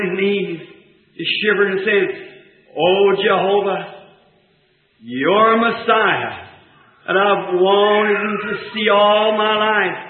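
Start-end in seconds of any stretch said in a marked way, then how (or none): 2.8-10.0 humble prayer. Retold or imitated. Bartimaeus kneels and prays.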